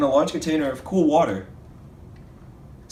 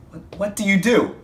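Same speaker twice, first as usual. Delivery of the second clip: frustrated; 6 semitones higher than usual. Real, not acted. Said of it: "I lost my temper for a second there".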